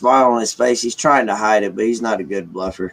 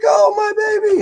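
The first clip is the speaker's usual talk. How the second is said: much higher imitation of mom's cracking voice; tearful mom; 3 dB louder than usual. Mom overjoyed for her son, imitated tearful squeak. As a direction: squeak it like a mother in happy tears. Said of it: "starting to cry and everything".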